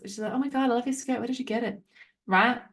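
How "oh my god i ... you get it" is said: a hint of a Mean Girls gush, a bit higher; sweetly compliment a skirt you hate; lightly mocked fake friendliness. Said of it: "what is this, Mean Girls?".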